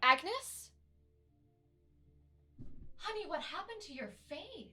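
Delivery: high, hushed motherly concern; rising, high voice full of motherly worry; worried mother. Acted. Mother seeing her daughter's face, shocked concern. Game master plays the mother.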